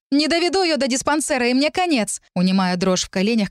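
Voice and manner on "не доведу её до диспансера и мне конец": high, desperate appeal; crying out. A narrator's reading. Narrator hiding trembling from the crowd, crying out. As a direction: cry it out high, pleading. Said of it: "кричала я".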